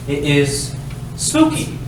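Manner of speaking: ordinary talk, nothing marked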